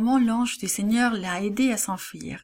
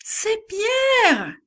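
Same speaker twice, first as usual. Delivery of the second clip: high, louder cry of joy; joyful cry; 13 semitones higher, 4 dB louder than usual. A narrator's reading. Servant girl recognizing Peter at the door, joyful high cry. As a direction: servant girl shouts the news with delight. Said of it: "crie Rhodes avec joie".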